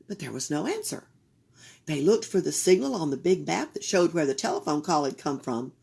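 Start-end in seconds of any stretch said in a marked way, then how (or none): none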